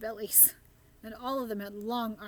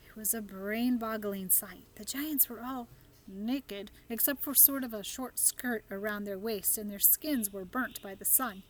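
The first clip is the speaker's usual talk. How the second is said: ordinary delivery, nothing marked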